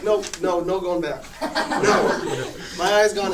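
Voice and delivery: loud laughing fake panic; laughing mock panic. Telling mom no going back, laughing loudly.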